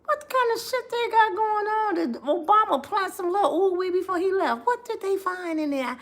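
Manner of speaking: high, soft disbelieving asking; hushed high mock bewilderment; incredulous quote. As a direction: wonder aloud, baffled and hushed. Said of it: "I said".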